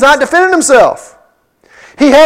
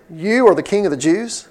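a softer, sarcastic jab at the word are; sarcastic question; 3 semitones lower, 8 dB quieter than usual. Shown as a demonstration. Pilate sarcastically questioning Jesus, quiet and mocking. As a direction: say You are like you doubt it, softly. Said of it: "Kind of sarcastically".

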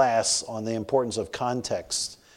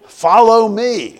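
called out; 9 semitones higher, 11 dB louder, much slower than usual. Retold or imitated. Lady calling out to him.

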